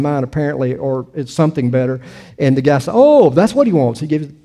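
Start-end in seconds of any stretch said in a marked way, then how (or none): 2.9-4.0 higher, louder oh of getting it; light up as it clicks; sudden realisation. Retold or imitated. Newsstand clerk suddenly understanding, mock surprised.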